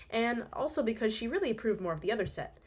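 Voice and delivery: plain reading voice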